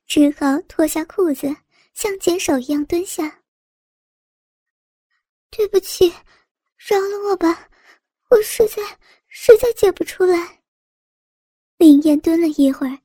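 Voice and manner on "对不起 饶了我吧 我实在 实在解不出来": high tearful plea, voice crying; pleading. Acted. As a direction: beg through tears. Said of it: "就哀求道".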